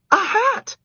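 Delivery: high loud shout; shouting girl. A narrator's reading. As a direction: shout it urgently. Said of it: "someone shouted".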